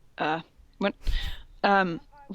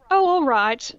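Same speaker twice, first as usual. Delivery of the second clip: smiling; 5 semitones higher, 5 dB louder, slower than usual. Acted. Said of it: "I said, smiling".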